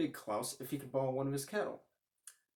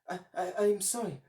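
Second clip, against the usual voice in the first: very nervous; 6 semitones higher, 5 dB louder than usual. Acted. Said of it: "very nervous".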